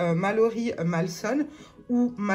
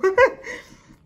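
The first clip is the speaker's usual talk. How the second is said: high loud burst of laughter; happy squealing laugh; bright laugh; 12 semitones higher, 11 dB louder than usual. Real, not acted. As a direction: let a real laugh out. Laughs at own joke.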